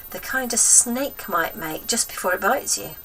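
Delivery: ordinary delivery, nothing marked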